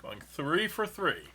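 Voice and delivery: booming boxing announcer; ring announcer. Acted. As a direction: announce the champion, big and grand. Announcing the winner.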